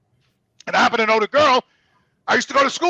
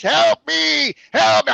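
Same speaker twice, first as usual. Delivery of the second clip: mock yell; 6 semitones higher, 4 dB louder, much slower than usual. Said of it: "People yelling from the rooms".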